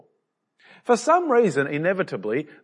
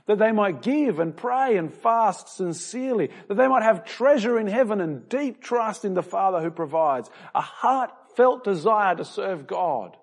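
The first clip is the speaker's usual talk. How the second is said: raised, fervent sermon voice; preaching; 6 semitones higher than usual. Acted. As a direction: lift the congregation toward God's kingdom. Preaching to the congregation.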